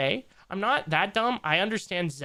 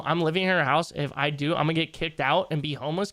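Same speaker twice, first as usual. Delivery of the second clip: ordinary talk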